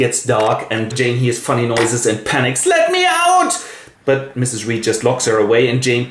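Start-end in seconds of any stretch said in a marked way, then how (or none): 2.7-3.5 high, frantic cry to be let out; panicked plea. Acted. Locked-in girl panicking, a high frantic plea. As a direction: beg desperately through the door. Jane locked in red room.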